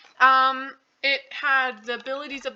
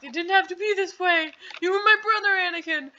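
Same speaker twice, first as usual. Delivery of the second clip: high-pitched melodramatic lament; theatrical parody of heartbreak; mock anguish; 7 semitones higher than usual. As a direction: cry out to a fallen brother, hammed-up. Acted anguished quote to fallen brother.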